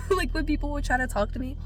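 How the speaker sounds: high laughing, talking through it. Real, not acted. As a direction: laugh as you speak. Laughing telling work story.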